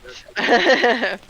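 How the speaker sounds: a loud rueful laugh. Real, not acted. Student laughing about her nursing fever story, loud rueful laugh.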